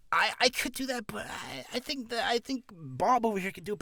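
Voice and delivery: high, hesitant, meek voice; self-underselling. Acted. Voice actor passes the job to someone else, meek self-underselling. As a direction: stammer it out high and unsure. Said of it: "you're underselling yourself".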